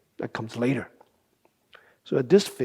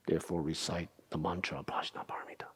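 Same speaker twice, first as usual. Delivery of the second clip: hushed, fast, reverent whisper; whispered reading; 7 semitones lower, 10 dB quieter, faster than usual. Shown as a demonstration. Teacher quoting the sutra to the class, whispered. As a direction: breathe the line like a secret. Reads sutra quote quietly.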